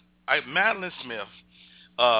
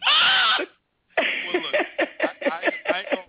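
high excited exclamation; 4 dB louder, slower than usual. Real, not acted. Excited burst after friend's joke.

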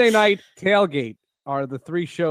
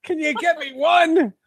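put-on much higher, little kid begging; high pleading kid voice; 11 semitones higher, 3 dB louder than usual. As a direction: beg like a small child in a store. Acting out kid in store.

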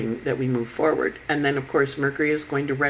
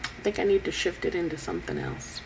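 higher-pitched musing aloud; voiced inner thought; 7 semitones higher, 4 dB quieter than usual. Someone weighing up their own choices, higher-pitched musing. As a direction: think it over aloud, raised pitch. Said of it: "look at the choices you've made and go".

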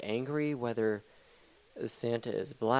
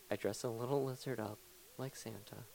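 usual speaking voice

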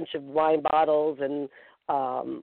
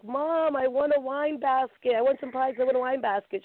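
excited to mom; 8 semitones higher than usual. Retold or imitated. Telling mom about prizes.

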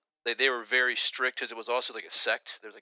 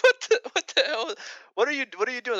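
much higher, louder disbelief; high shocked exclamation; 13 semitones higher, 4 dB louder than usual. Retold or imitated. Young student reacting to teacher flicking pennies, shocked. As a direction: recoil in disbelief.

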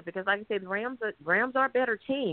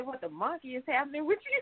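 mock quote; 5 semitones higher, 6 dB quieter than usual. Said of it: "You say".

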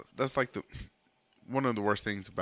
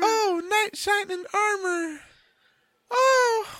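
swooning damsel; 5 dB louder, much slower than usual. Pretend. Acting damsel love scene.

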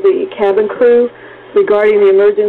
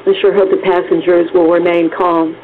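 normal talking voice

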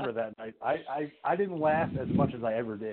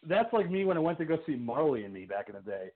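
normal talking voice

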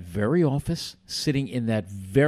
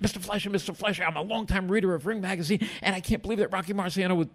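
fast, breathless gushing, pitched up; rushed excited fan; 6 semitones higher, faster than usual. Retold or imitated. Young fan gushing to his idol, fast excited voice. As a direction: tumble the words out like a starstruck kid. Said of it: "Okay, slow down".

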